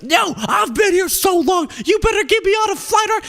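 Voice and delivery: high, shrill mock demand, shouted; mock angry passenger. Passenger demanding a flight from Delta staff, mocking high yell. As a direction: squeal it like an entitled customer. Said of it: "she's like".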